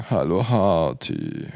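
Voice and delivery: tief, langsam und leise, wie ein Greis; hundertfünfzig Jahre alt klingen; theatralische Altmännerstimme. Pretend. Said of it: "theatralisch und mit hundertfünfzig Jahren Lebenserfahrung in der Stimme". (theatralisch alter Mann, tief und gedehnt)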